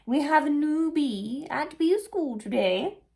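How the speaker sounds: high, loud, slow announcement; queen bee announcing. Acted. Queen bee announcing the new pupil, high and slow. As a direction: announce it like a proud head teacher bee. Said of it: "said the queen".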